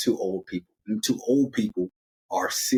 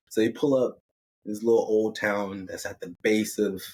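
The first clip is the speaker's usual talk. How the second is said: usual speaking voice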